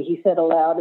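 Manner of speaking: ordinary delivery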